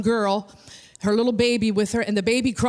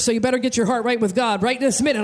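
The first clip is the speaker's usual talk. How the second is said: usual speaking voice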